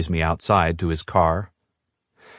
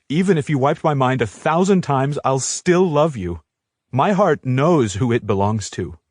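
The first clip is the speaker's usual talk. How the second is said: angry, pleading love; 6 semitones higher than usual. A narrator's reading. Angry love declaration to Jared.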